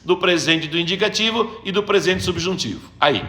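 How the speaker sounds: normal talking voice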